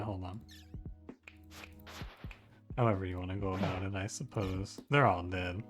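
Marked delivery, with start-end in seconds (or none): none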